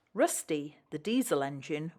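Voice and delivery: plain reading voice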